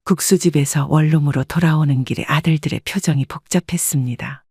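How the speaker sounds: normal talking voice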